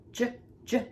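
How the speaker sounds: J said as a short buzzing "j" sound twice; letter sound. Shown as a demonstration. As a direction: sound out the letter J like a phonics teacher. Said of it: "Make the sound J".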